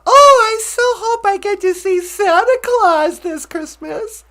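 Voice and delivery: high, loud mock wishing; hammed-up excitement. Acting eager to see Santa, high hammed-up gushing.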